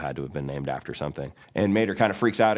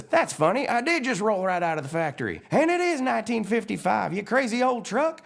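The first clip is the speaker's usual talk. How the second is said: high-pitched cheeky truck character; blue Mater. Acted. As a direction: retort in a raised country-truck voice. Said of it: "And the blue Mater says".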